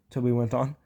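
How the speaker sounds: ordinary delivery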